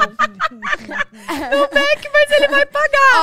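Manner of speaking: high, loud, slow teasing repeat through laughter; mocking laugh. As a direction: echo her line back, cracking up. Friend mocks the retold answer.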